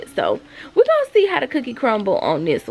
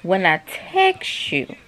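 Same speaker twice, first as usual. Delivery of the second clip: stern scolding; much slower than usual. Real, not acted. Mom scolding someone who ignores her calls, slow and stern. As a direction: lay down the rule word by word.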